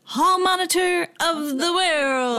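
slow grand title announcement; announcer voice. Pretend. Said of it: "We gotta come up with a tune".

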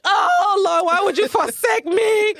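hammed-up high wailing cry to the heavens; mock-tragic high shout, near tears; dramatic wail. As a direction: howl it like a soap-opera heroine in the rain. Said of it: "this is the most dramatic day".

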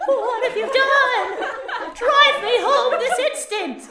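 mother scolding her grown son, mock outrage; angry mom. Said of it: "his mom comes running and says".

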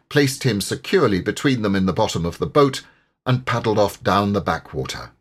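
usual speaking voice

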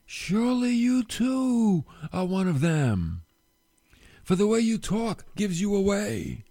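drawn-out suspicious challenge; bystanders accusing. Acted. Bystanders confronting Peter, slow and accusing. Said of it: "they said to Peter".

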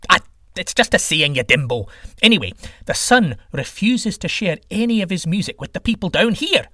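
irritated god getting back to the point; impatient sky god. Acted. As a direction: brush off a silly question.

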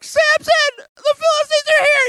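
very high, loud alarm cry; Delilah. Retold or imitated. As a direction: shriek a mock warning in falsetto. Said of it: "Delilah's like".

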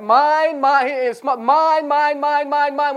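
mock a grabby kid clutching a toy; selfish child. Pastor mimicking a selfish child for the congregation, whiny mock chant. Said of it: "kids are good at that".

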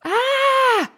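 very high, drawn-out "ah"; high storybook squeal of realization; excited gasp. A narrator's reading. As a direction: gasp aloud with a sudden idea. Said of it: "gasped Larissa".

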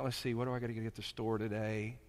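soft, fast, absent-minded aside; thinking about shopping mid-broadcast; distracted musing. Daydreaming listener thinking.

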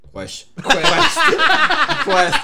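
high, loud laughing quest; laughing repetition. Pretend. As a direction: crack up on the word. Joking about the word quest.

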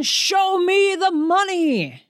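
high, drawn-out movie catchphrase cry; mock movie yell. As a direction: stretch it out high, like a famous film holler. Famous movie line acted out.

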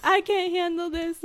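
high, mock-overwhelmed swoon; collapsing melodrama. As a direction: act as if collapsing into a chair, hammed up. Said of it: "collapses into a chair".

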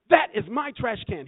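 loud, high, each word hammered; indignant. Retold or imitated. Friend confronting trash can thief, indignant and loud. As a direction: claim it back with force. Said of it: "He became indignant".

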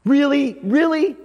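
high incredulous really; mock disbelief. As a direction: scoff in doubt at the claim. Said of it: "You're like".

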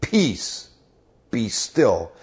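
calm the storm with one word; commanding calm. Retold or imitated. Jesus stands and speaks to the storm, commanding. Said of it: "he stood up and he said".

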